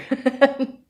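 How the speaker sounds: bright burst of laughter. Real, not acted. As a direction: laugh out loud at yourself. Laughs at own realization.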